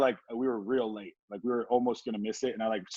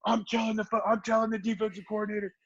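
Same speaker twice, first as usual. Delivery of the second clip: pitched-up mock coach threatening; hammed-up coach under a pile of blankets; coach's threat; 10 semitones higher than usual. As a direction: squeal the threats like a flustered coach. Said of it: "and he's like".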